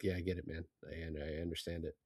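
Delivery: low quiet grumble, fast; muttered waiter voice. Retold or imitated. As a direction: mimic a fed-up waiter grumbling under his breath. Imitating the Chinese waiter.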